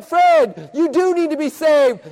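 high, loud insistence; emphatic urging. Retold or imitated. [name] urges his older brother Freddie, loud and insistent. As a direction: bear down on him at preaching volume.

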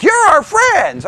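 high, slow gushing cry; mock fawning. Mimicking preterists praising him, high slow gushing. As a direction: gush like a sudden ally. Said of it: "preterists were like".

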